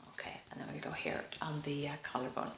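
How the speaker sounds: a soft, unvoiced aside to herself; hushed murmur to herself; whispered aside. Real, not acted. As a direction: whisper the instruction under the breath. Whispered aside during tapping.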